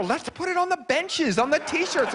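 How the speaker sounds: high-pitched eager boasting; proud pitch. Retold or imitated. Proud resident urging the mayor to advertise it, high excited pitch. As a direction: sell it high and excitedly, like a civic slogan. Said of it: "I was proud. I told the mayor".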